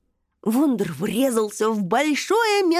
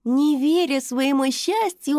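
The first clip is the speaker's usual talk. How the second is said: ordinary delivery, nothing marked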